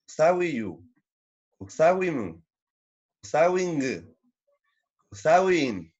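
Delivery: each form said apart with a pause, steady and clear; slow drill of each usawi ending; conjugation drill. Shown as a demonstration. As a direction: recite the forms one by one for students. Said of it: "En este caso sería".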